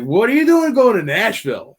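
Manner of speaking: mock-incredulous retold question. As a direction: sound amazed they'd pick Nashville.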